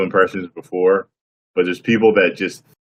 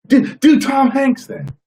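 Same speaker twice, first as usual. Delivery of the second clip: high, slow mock fan voice; imitating a fan; 5 dB louder, slower than usual. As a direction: drag it out way up high like a pushy kid. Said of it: "I can't do Tom Cruise".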